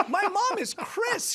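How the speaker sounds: high, slow retold insistence; drawn-out, high-pitched re-enacted plea; exasperated explaining. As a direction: spell it out in disbelief. Retold insisting to forgetful godmother.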